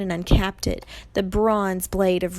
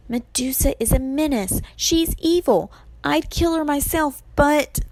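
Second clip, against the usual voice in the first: lightly voiced higher-pitched girl, insistent; urgent Annabeth; 8 semitones higher, 3 dB louder than usual. A narrator's reading. Annabeth persuades Percy to fight Medusa, urgent. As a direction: press the case earnestly, pitched up.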